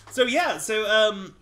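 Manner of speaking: ordinary talk, nothing marked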